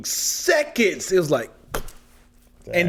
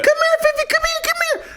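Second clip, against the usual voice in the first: calling a dog in a high sing-song; sweet high dog-calling voice; high coaxing call; faster than usual. Retold or imitated. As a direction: coax the dog over, pitched way up.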